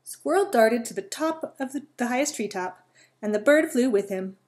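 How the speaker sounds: lively read-aloud voice with little character voices; storytelling. A narrator's reading. Reading a squirrel and bird story to children, lively. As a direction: tell a bedtime story to young listeners.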